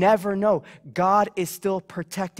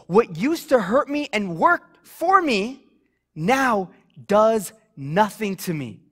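ordinary talk

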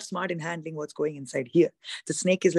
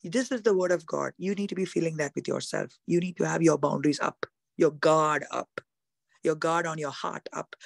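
ordinary delivery

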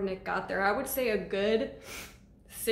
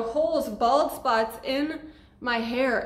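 ordinary delivery

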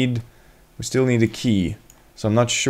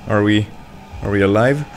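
usual speaking voice

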